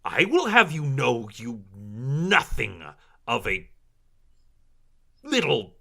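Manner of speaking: high, loud indignant outburst; outraged villainess, struggling for words; furious drow matron. Acted. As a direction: sputter with offended pride. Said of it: "She is so mad".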